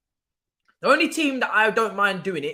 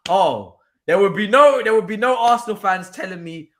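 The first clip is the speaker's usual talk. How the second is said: normal talking voice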